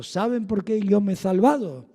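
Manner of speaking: put-on mild Castilian accent, lisped z in salvado; a light Spain Spanish accent, softly. Quoting Lacueva's testimony with a mild Spanish accent. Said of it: "Bien con su acento español".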